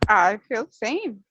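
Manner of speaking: usual speaking voice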